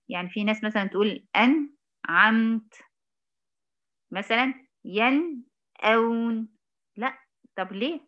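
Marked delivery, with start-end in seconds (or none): 1.4-2.7 "أنعمت" cut by a silence after the ن; silent pause. Shown as a demonstration. Teacher mimicking some people, "أنعمت" with a pause after ن. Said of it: "أو سكت". 4.8-6.5 "ينأون" slowly split after the first ن; silent pause. Shown as a demonstration. Teacher showing the mistake, "ينأون" with a pause after ن. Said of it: "نعمل السكت أو الفصل الزمني ده".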